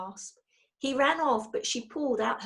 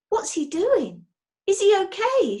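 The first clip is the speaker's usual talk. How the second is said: anxious; 11 semitones higher, 7 dB louder than usual. A narrator's reading. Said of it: "said Missus Brown anxiously".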